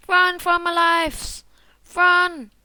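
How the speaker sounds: loud, high drawn-out holler; high panicked shouting; fleeing yell. Real, not acted. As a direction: yell it as you run away. Yells while fleeing in game.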